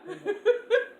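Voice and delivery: squealing laughter; high laughing squeal. Real, not acted. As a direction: burst into shrieking laughter. Laughing at the makeup.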